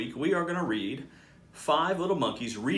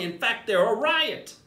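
tickled monkey; 9 semitones higher, 4 dB louder than usual. Acted. Monkey giggling about jokes.

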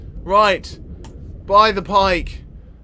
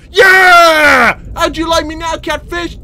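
triumphant shout; 7 semitones higher, 10 dB louder, slower than usual. Real, not acted. Taunting beaten catfish.

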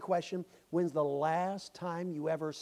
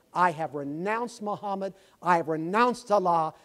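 louder, firmly declaring it; proud declaration; 2 semitones higher, 9 dB louder than usual. Retold or imitated. Convert declaring to the preacher, louder and firm. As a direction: proclaim it like a vow.

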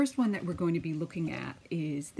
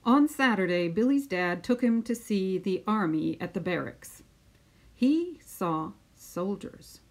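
put-on lively storytelling voice for children; picture book read-aloud; 4 semitones higher than usual. Teacher reading a picture book to her class, lively storytelling. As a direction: read it out like story time on the mat.

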